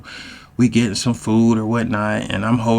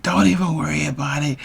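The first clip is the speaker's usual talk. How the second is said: higher-pitched, mildly miffed imitation; pissed girlfriend; 5 semitones higher than usual. Girlfriend snapping back at his worry, mock pissed. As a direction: brush him off like an annoyed girlfriend, mock-miffed. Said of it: "Like, she gets pissed".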